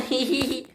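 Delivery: high, soft "hee hee hee"; giggle. Acted. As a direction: titter lightly on a high pitch. Nia giggles after uh-oh.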